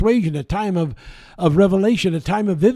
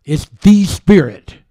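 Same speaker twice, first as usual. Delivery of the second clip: THE punched loud and drawn out; slow, emphatic THE to show it is definite; stress on THE; 3 semitones higher, 9 dB louder than usual. Shown as a demonstration. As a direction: hit "the" hard before "Spirit". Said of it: "It's definite".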